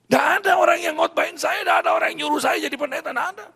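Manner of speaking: shouted high and loud, insisting; emphatic shout. Real, not acted. Insisting no one made him preacher. (teriakan tegas bernada tinggi)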